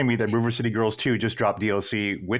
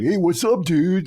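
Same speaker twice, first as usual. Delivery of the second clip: Aaron's voice; 7 semitones higher, 3 dB louder, slower than usual. Retold or imitated. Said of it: "it just sounds, it just fucking Aaron's voice".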